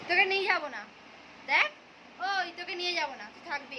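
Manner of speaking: playful threat. Pretend. Teasing threat to toddler.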